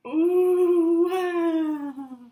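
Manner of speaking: high, loud sung ooh wow; floaty ooh. Shown as a demonstration. Leading kids in floating practice, sung and high. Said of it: "Should we all practice floating".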